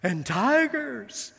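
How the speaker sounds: high, slow little girl imitation; lightly voiced young Dorothy, drawn out. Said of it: "And Dorothy says".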